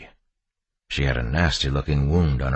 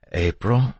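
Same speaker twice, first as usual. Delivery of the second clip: tentative call; 7 semitones higher than usual. A narrator's reading. Husband tentatively checking on wife.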